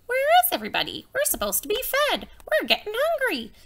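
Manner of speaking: high, very fast clucky voice; chickens talking. Acted. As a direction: fuss like a hungry hen. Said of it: "all the chickens said".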